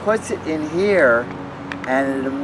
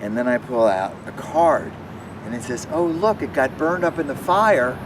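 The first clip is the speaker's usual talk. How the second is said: put-on children's magic show patter; 4 semitones higher than usual.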